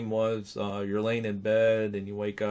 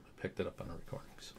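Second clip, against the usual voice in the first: quick hushed whisper; 4 semitones lower, 15 dB quieter, faster than usual. Real, not acted. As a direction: drop to a secret whisper. Hushed aside about the EVP.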